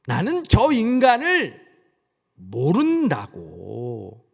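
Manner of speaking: put-on slow Gyeongsang accent straining for Seoul speech, endings pushed up; a Gyeongsang man faking Seoul dialect, slow and drawn out; Gyeongsang speaker forcing Seoul speech. Peter denying Jesus in forced Seoul speech, slow with a Gyeongsang accent. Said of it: "마치 경상도 사람이 서울말 억지로 쓸 때 끝에를 올리잖아요".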